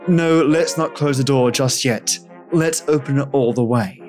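ordinary delivery

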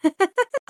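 short bright laugh; amused laugh. Real, not acted. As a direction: laugh at the silly claim. Reacting to fake job claim.

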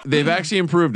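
fast, laughing through the words. Real, not acted. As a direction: talk fast while cracking up. Laughing after the teasing chant.

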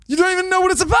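very high-pitched, incredulous retort; exasperated protest. Real, not acted. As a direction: squeal it in exasperated disbelief. Exasperated retort in film argument.

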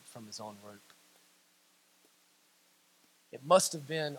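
low, very soft sermon voice; hushed preaching. Real, not acted. Preacher pondering Judas and the executioners, hushed and solemn. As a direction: speak low, letting the thought sink in.

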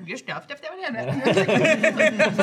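squealing rewound-speech gibberish; rewind voice. Acted. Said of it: "is the rewind voice".

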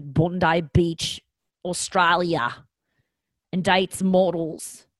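put-on slow, drawn-out Australian accent; mock Australian accent, stretched out. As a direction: put on a lazy Aussie accent. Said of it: "slipping into Australian accent again".

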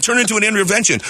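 ordinary talk

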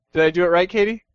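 ordinary talk, nothing marked

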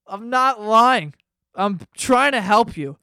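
slow loud frustrated plea; exasperated insistence. Retold or imitated. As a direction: insist he's telling the truth, fed up. Arguing with the catfished guy.

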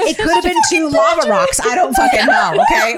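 high, loud shout through laughter; laughing exasperation. Real, not acted. Storyteller teased by friends over the instrument, real laughing exasperated shout. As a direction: shout it out, giving up and cracking up.

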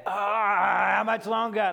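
high, loud, slow whine; whiny child. Retold or imitated. Son whining at dad for the iPad. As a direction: stretch it out like a nagging kid.

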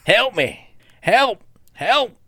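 high, loud parroted yells; mimicked cry for help. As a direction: shout help like a copying crab. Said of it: "That's what they mimic".

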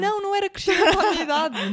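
high, loud, fast protest; indignant. Real, not acted. Indignant denial to friend.

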